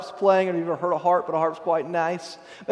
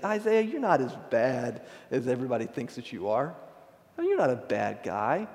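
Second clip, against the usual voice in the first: soft, lowered consoling voice; mock reassurance; 5 semitones lower, 7 dB quieter than usual. Seraph imagined comforting Isaiah, soft mock reassurance. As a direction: gently let him off the hook, softly.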